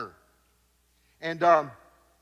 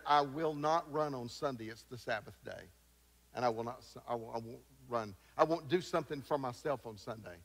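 quiet refusal; 6 semitones lower, 8 dB quieter than usual. Retold or imitated. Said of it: "And he said".

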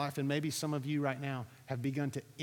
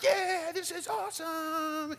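much higher, slow and stretched out; a retold thrill at the freedom of the fall; 11 semitones higher, slower than usual. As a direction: ride the rush of flying. Thrilled while free falling.

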